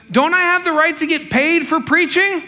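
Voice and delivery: high, loud incredulous question; Paul quoted. Retold or imitated. Preacher acting Paul asking about pay, high and loud. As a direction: lift it high as Paul protesting. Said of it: "he goes".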